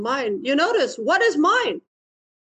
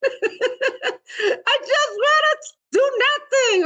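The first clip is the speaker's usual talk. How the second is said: much higher, slow laughing whine; whiny toddler; 12 semitones higher, much slower than usual. Retold or imitated. Teacher mimicking the lazy inner child, drawn-out laughing whine. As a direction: drag it out like a sulky two-year-old. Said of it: "your two-year-old self".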